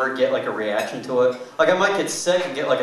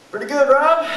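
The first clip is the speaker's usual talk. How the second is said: pinched, much higher strained reply; 14 semitones higher than usual. Retold or imitated. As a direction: squeeze it out while clenching. Straining while holding it in.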